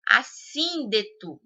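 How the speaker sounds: Assíndeto slowly, with SÍN stretched; stress on second syllable. Shown as a demonstration. Teacher giving a proparoxytone example, stress on SÍN in Assíndeto. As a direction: lean long on the -sín- of Assíndeto. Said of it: "sim aí, ó, é mais forte".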